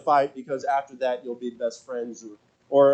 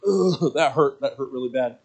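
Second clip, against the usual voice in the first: ordinary talk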